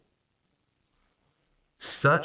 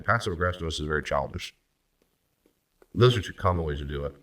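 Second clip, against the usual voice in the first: normal talking voice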